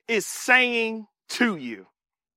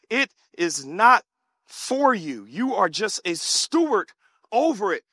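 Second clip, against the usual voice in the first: usual speaking voice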